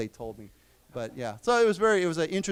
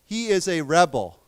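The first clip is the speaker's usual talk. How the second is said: louder, "rebel" said REB-el, the person; stress on first syllable; 6 dB louder than usual. Shown as a demonstration. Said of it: "the accent is different".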